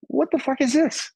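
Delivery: can't believe it; amazed. Retold or imitated. Meeting clean-cut Blackie, high stunned surprise. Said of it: "I was like".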